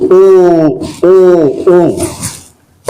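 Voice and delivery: long round O, spoken three times; drilled vowel O. Shown as a demonstration. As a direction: model the vowel O slowly for learners. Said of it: "Listen to me very attentively".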